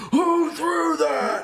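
high, drawn-out mock roar; Hulk-style rage. Youth leader imagining going Hulk on a crowd, mock-raging shout. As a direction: bellow it like the Hulk, hammed-up. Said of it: "go Hulk on everybody".

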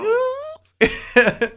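high, breathy thrilled ooh; excited ooh. Retold or imitated. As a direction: squeal a delighted ooh. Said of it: "I was like".

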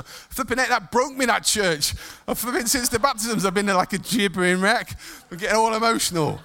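real choked-up, rising voice; getting emotional. Real, not acted. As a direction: fight back tears while exclaiming at yourself. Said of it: "I'm getting emotional".

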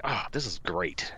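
soft, pleased under the breath; quiet satisfied. Retold or imitated. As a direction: murmur it contentedly. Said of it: "I was like".

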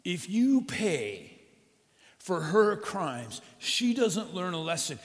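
loud, high, slow angry rant; furious shopkeeper demanding punishment, loud and venomous. Acted. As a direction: spit it out with venom at the mayor. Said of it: "after he speaks with all his venom".

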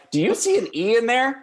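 high-pitched, indignant challenge; mock exasperation. Football viewer correcting the mispronunciation, high mock exasperation. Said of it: "and I'm like".

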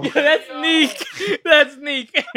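laughing. Real, not acted. Teasing laugh at friend.

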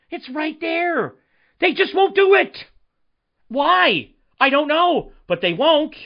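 "They just won't do it" burst out high and loud; fed up, let it rip; exasperated outburst. Real, not acted. Frustrated fan venting about the coach, loud and exasperated.

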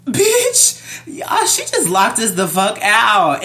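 high, loud, indignant exclamation; retold outrage.

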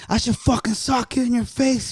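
high-pitched reenacted threat; retold angry threat. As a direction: replay squaring up to a friend. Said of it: "I got in his face".

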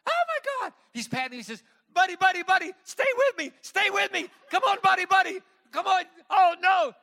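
put-on high, loud frantic pleading to a limp baby; a father shouting in panic to wake his son; panicked father. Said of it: "Josh freaks out".

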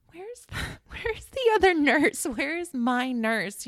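high, laughing mock question, slow at the end; laughing plea. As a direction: ask for the missing nurse through a laugh. Asking nurses for her nurse.

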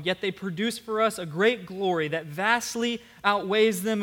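measured, building scripture reading that swells loud in the middle. Acted. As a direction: read the verses with rising conviction. Reads the scripture passage aloud.

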